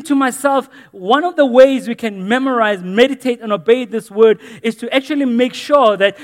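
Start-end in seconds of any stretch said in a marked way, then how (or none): none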